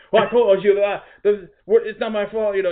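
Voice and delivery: high, loud imitation of the Haitian cleaner's accent; mock Haitian accent. Coworker imitating the Haitian cleaner's defensive protest, mock Haitian accent. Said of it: "he's, like, Haitian or something, so he kinda speaks weird".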